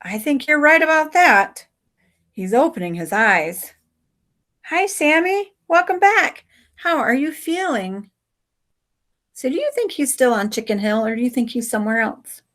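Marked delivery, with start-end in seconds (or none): 4.7-8.1 high, tender nurse-like voice; gentle greeting. Acted. Someone welcoming Samson back, tender and high. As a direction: soothe him as he wakes.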